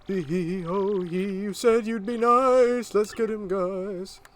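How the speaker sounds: high, loud, drawn-out ghostly cackle and taunt. Acted. Boo turns on the player who broke a promise, wailing ghost voice. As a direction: wail it high and slow, rallying the gang. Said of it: "I'll give him a ghost voice".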